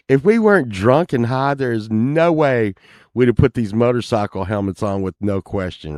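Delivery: ordinary delivery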